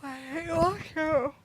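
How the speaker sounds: slow words through a yawn. Real, not acted. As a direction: talk mid-yawn, stretched out. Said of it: "Why are you yawning?".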